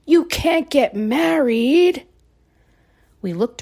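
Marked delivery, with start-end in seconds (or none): 0.0-2.0 loud, slow, menacing; scary voice. Acted. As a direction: boom it out like a villain. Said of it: "said a very scary voice".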